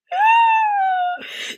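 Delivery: a high, loud shriek of laughter; sudden high-pitched howl of laughter. Real, not acted. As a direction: burst out in a squealing laugh. Bursting out laughing on camera.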